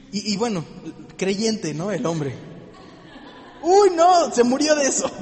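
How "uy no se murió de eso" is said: laughing mock. Repeats the friend's line, laughing.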